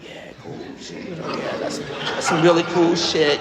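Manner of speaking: stoned half-listening, mock interest; mock stoned reply to the driver's story; high and humoring. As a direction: nod along without caring.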